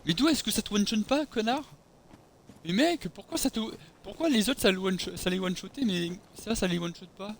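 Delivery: exasperated high-pitched rant; raging gamer. Real, not acted. Frustrated rant after dying.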